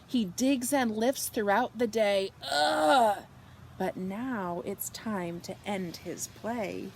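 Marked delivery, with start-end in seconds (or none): 2.5-3.2 loud, high effort grunt. A narrator's reading. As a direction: grunt as if lifting heavy dirt. Excavator digs and lifts.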